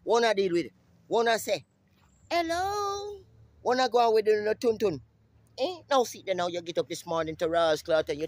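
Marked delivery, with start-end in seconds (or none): 2.3-3.2 high hello tossed out; high call. Acted. Hailing the viewers with a high hello. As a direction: sing it up high. Calling out greeting.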